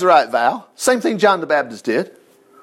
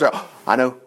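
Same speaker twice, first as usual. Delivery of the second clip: lower, offhand false prophet; mock know-it-all priest; 7 semitones lower than usual. Preacher mocking a pretend prophecy, low and offhand. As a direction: rattle it off like a fake prophet. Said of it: "He didn't have a thing from the Lord".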